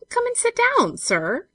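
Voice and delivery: loud, high-pitched summons; playful call. A narrator's reading. Olive patting the brickwork for him, calling playfully. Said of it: "cried Olive".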